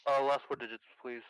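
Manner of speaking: carbon mic. Real, not acted. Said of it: "you do sound like a phone".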